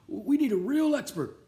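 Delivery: put-on high, worried old janitor voice. A narrator's reading. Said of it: "said Pop".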